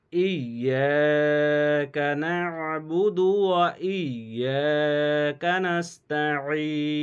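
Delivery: slow, drawn-out chanted recitation; Quran recitation. Shown as a demonstration. Teacher reciting the verse for students in slow chant. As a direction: recite the verse slowly and melodically. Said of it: "আয়াতটি আমি গোড়া থেকে বলছি".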